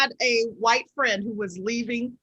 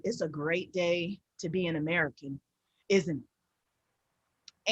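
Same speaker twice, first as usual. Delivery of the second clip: tag withheld; 7 semitones lower, 9 dB quieter, slower than usual. Retold or imitated.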